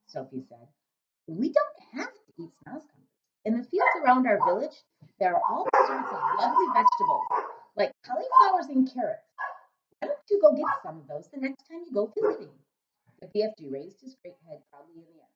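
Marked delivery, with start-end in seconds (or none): 1.3-12.5 put-on lightly higher little girl; girl's voice. A narrator's reading. Sophie suggesting vegetables to the giant, lightly higher girl voice. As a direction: coax him gently as a little girl.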